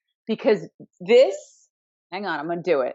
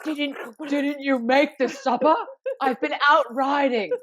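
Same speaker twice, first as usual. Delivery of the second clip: higher, hammed-up period hero; mock Poldark; 4 semitones higher than usual. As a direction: ham up the offended husband. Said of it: "walks up to her and he's like".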